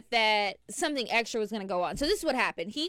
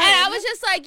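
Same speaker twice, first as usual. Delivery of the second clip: loud excited retelling; 7 semitones higher, 8 dB louder than usual. Real, not acted. Builds to retelling outburst.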